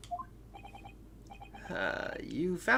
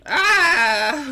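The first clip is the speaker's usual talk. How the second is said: sharp high shout of alarm; loud startled yelp; startled cry; 14 dB louder than usual. Acted. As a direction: yelp in shock at waking up cuffed. Waking up handcuffed, startled.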